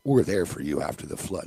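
low, soft, mock-solemn ad read; hushed fake sincerity of a TV ad; melodramatic insurance ad. Mocking an insurance ad, low and soft melodramatic read. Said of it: "they do the melodramatic music".

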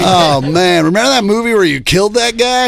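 loud, high mock fan; hammed-up over-familiar chatter; Bobby Kelly. Playing a comic recalling Tom Hanks films, Bobby Kelly impression. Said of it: "Can I be Bobby Kelly".

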